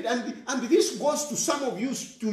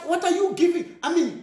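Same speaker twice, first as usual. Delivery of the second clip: ordinary delivery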